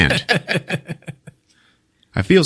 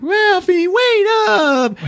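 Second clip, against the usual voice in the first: very high, wailing call; mock crying kid; much slower than usual. Crying boy trailing behind his brother, hammed-up wail. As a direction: cry out like a tearful little brother. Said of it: "Randy is behind crying".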